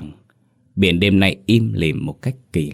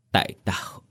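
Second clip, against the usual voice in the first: tearful whisper; 6 dB quieter than usual. A narrator's reading. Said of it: "Nước mắt nóng hổi trào ra".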